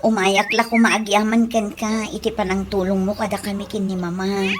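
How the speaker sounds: low, soft and subdued; humble thanks. Acted. Former mocker thanks the one who helped Mama, low and humble.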